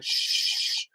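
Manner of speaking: one long, soft shush. Retold or imitated. Waiter waving at the comic, a long shush. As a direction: let out a drawn-out hush. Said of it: "he's going like".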